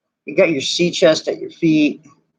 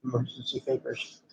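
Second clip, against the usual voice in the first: a breathy little unvoiced aside; whispered aside; 3 semitones lower, 15 dB quieter than usual. Real, not acted. Player murmuring a joke to the table, whispered. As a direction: whisper it under your breath.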